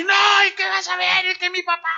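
high, shouting bratty threat; child's tantrum. Retold or imitated. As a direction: yell it like a toddler who'll tell dad. (niño gritando amenazas, muy agudo)

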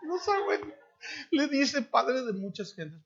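high mocking gossip voice; mock the neighbors' chatter; slow high scandalized gossip; gossiping neighbors.

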